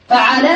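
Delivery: فعل said fa-'a-la, middle vowel a; fatha on ain. Shown as a demonstration. Teacher listing verb patterns, فعل as fa'ala. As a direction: say فعل with an open a after the ain. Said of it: "بفتح العين".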